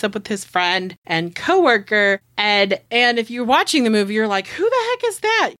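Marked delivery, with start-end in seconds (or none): 4.5-5.5 very high-pitched puzzled exclamation; mock bewilderment. Viewer failing to place an actor, mock bewilderment. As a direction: imitate a baffled viewer, pitched way up.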